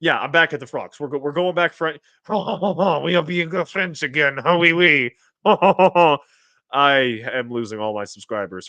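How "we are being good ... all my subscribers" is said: hammed-up French accent with a mock laugh; drawn-out, badly faked French accent; horrible French accent. Streamer joking about boiling frogs, horrible French accent. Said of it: "that horrible French accent".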